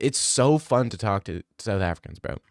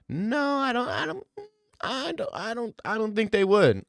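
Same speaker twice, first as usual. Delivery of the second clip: much higher, squeaky flustered denial; 12 semitones higher than usual. Acted. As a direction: squeak out a nervous protest. Squeaky protest voice.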